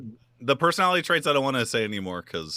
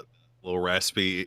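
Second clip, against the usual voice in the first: normal talking voice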